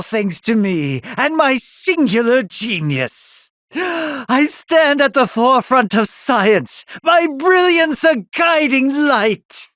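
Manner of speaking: soar up as you crown yourself a genius; grandiose boast. Acted. Mad scientist boasting of genius.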